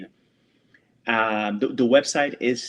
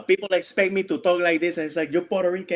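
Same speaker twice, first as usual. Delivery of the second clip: higher-pitched mock Cuban accent; joking Cuban accent, pitched up; bad Cuban accent; 7 semitones higher than usual. Shown as a demonstration. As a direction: do a badly faked Cuban accent. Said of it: "I'm doing a bad Cuban accent".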